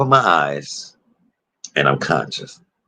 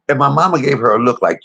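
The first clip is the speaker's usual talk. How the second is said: ordinary talk, nothing marked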